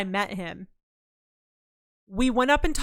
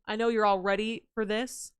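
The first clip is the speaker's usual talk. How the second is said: normal talking voice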